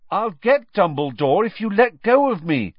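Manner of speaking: urgent loud bargain. A narrator's reading. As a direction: promise it urgently to break free. Harry trying to free himself.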